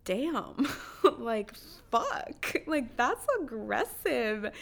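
loud, drawn-out amused disbelief; laughing mock shock. Imagined man reacting to a woman's blunt no, laughing mock shock. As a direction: react through laughter as if bowled over, loudly.

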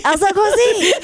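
high, hammed-up French name; French accent. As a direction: mimic a Frenchman announcing a designer. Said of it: "the guy with the accent".

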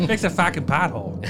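put-on thick Boston accent, dropped r's. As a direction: say it like a Boston local. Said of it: "Best Boston accent".

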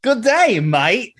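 mock Aussie greeting, meant as British; hammed-up, muddled Australian accent; bad Australian accent. Co-host testing a UK greeting that comes out in an Australian accent. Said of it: "That's Australia".

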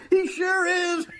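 cackling with glee, pitch climbing high; gloating laughter. Acted. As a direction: laugh through the words, savouring the triumph. Gloating over catching convict.

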